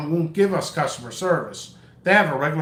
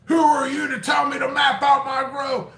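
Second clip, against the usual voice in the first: higher whiny mock customer that rises into a shout; 4 semitones higher than usual. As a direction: ham up an indignant customer who refuses advice. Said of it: "Do you know people argue with me every year?".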